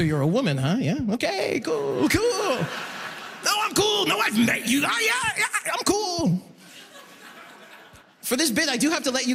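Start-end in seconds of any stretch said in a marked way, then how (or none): 0.0-1.0 low, fake-casual date talk; playing it cool. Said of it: "obviously I play it cool". 1.1-6.4 high, overexcited, flustered insistence; failing to act cool. Acted. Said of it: "obviously I play it cool".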